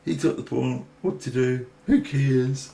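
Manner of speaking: high, squeaky mock voice of a careless opponent. Mocking Russell's play, high squeaky cheer. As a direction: play him as a silly, careless cheering player. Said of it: "And he just goes".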